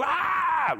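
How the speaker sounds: high-pitched held "ah" yell; demonstrated wordless shout. Shown as a demonstration. Preacher showing a time just to let it go, demonstrated shout. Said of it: "a time just to go".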